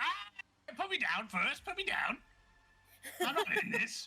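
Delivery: high, squealing alarm; panicked protest. Acted. He is being carried into the ring, high-pitched panicky protest. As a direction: plead frantically to be set down. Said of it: "And he's like".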